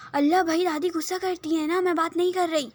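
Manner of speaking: high, loud whining refusal; whiny protest. Acted. Sister refusing to talk to grandma, whining protest. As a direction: beg off like a sulky sister.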